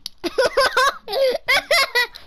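a burst of high-pitched laughter. Real, not acted. Laughs after a play.

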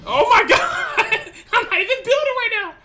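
high, loud laughing yell of triumph; laughing shout. Real, not acted. Gamer winning without building, loud laughing yell. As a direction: howl with laughter as you win.

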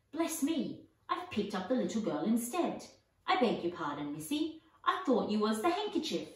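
ordinary delivery

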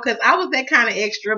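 ordinary talk

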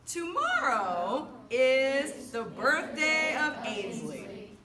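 loud, drawn-out, syllable by syllable; slow choral-reading lead. Shown as a demonstration. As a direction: lead a class reading aloud together, very slowly. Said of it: "I'll say it the French way".

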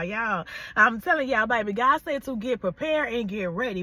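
usual speaking voice

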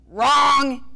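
a loud, high yell. A narrator's reading. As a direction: bark out the verdict. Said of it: "yelled Ace".

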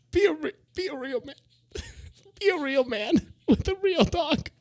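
very high, strained squeal through laughter; put-on ad voice. Squealing the slogan of a dog daycare ad, put-on high voice.

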